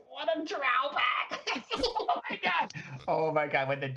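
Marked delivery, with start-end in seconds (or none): 0.1-2.7 very high, drawn-out laughing cry. Real, not acted. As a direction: squeal it out through laughter. Cracking up over the incident.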